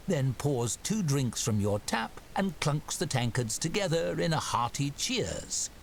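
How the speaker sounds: ordinary talk, nothing marked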